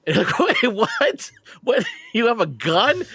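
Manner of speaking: high, loud shocked disbelief. Retold or imitated. Conductor hears the passenger has a gun, shocked. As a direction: yelp it in total disbelief. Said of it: "I was shocked".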